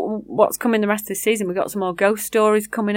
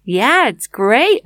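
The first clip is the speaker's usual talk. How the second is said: attempted Texan accent; 5 dB louder, much slower than usual. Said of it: "I can't do the accent".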